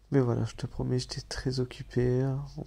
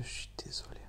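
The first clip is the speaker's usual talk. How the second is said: barely voiced whisper; whispered sorry; 11 dB quieter, faster than usual. Real, not acted. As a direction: drop to a whisper on the apology. Excuse droit dans les yeux. (excuse chuchotée tout bas)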